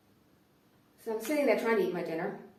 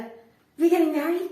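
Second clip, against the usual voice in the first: higher and louder; pushy question; 6 semitones higher, 9 dB louder, faster than usual. Retold or imitated. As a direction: press the question harder. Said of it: "he'd be like".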